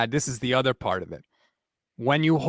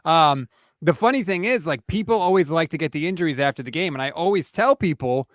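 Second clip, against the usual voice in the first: ordinary delivery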